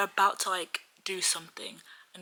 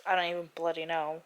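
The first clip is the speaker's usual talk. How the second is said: normal talking voice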